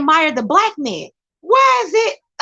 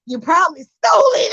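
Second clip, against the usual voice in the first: mock accusation; 5 semitones higher than usual. Acting a rude accusation.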